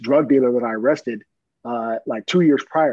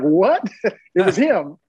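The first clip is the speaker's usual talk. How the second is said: sharp higher, louder startled question; surprised; 6 semitones higher, 5 dB louder, slower than usual. Retold or imitated. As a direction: jolt of sudden recognition. Recognizing the former dealer.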